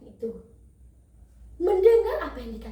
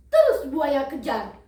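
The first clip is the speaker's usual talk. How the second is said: ordinary delivery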